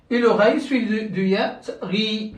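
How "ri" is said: "ri" with a long held ee; long vowel. Shown as a demonstration. Teacher showing ghain followed by ya, "ri" held long. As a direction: draw out the vowel of "ri". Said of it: "suivie d'une voyelle longue".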